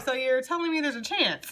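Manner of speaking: lightly imitated movie-meme line, a bit high; meme quote. Card reader quoting a meme to viewers, lightly hopeful imitation. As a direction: quote the meme with amused hope. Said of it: "They're giving me that meme".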